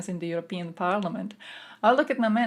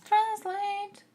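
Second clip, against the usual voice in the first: high squeaky command; 13 semitones higher than usual. Acted. High-pitched command to himself.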